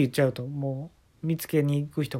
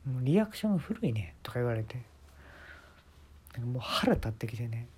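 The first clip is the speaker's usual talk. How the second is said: whispered put-down; 6 dB quieter than usual. Retold or imitated. Quoting Jacob's put-down.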